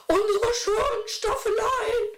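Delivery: hoch gejammert, mit einem halben Lachen; übertrieben wehleidig klagen wie eine verwöhnte Dame; jammernd. A narrator's reading. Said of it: "jammerte eine". (hohes, gespieltes Jammern)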